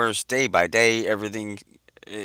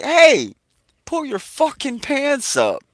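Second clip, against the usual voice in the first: much higher and louder barked command; imitating a yell; 5 dB louder, slower than usual. Buddy Lou yelling at the naked man, loud. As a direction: bark it like you're ordering him off the lot. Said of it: "My buddy Lou yells at this fucking dude".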